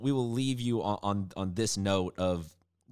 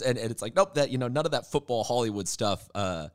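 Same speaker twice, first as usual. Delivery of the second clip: usual speaking voice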